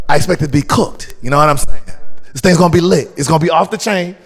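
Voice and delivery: put-on loud, hyped British geezer accent; best British accent. Guest hyping tonight's show on request, in a British geezer accent. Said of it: "in your best British accent".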